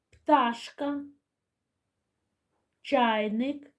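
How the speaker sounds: distinct single words with long pauses; name each item clearly for children; deliberate, lifted word-by-word dictation; calling out words. Shown as a demonstration. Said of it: "Я називатиму слова".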